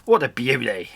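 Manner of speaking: usual speaking voice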